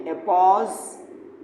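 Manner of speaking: एपोस्ट्रॉफे repeated slowly with a rising end; एपोस्ट्रॉफे, each syllable clear, tune going up; careful pronunciation. Shown as a demonstration. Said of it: "इसको पढ़ते भी यही है". (एपोस्ट्रॉफे धीरे से, अंत में सुर ऊपर)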